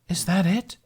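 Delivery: high, let-down question; disappointed. Acted. Mermaid Magali to Hiccup when his tale stops, disappointed. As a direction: ask it as if the story ended too soon. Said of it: "sounding disappointed".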